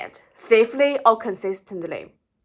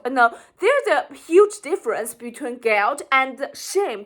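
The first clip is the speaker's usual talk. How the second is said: ordinary delivery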